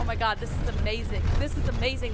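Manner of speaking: thrilled, breaking from the story; excited aside. Real, not acted. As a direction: gush with real delight. Reader's own aside.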